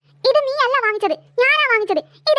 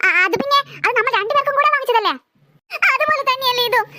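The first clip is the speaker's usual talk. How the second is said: ordinary talk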